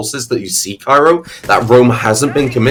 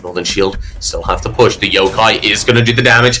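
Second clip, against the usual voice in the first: ordinary talk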